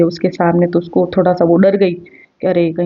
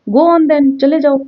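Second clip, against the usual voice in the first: mocking; 7 semitones higher, much slower than usual. Teacher acting wife mocking husband.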